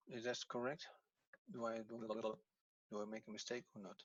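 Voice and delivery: very soft, half-voiced self-questioning; muttering to oneself. Real, not acted. Teacher checking own exercise for a mistake, muttering. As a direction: think aloud under your breath, barely projecting.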